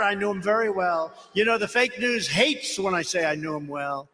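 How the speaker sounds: ordinary talk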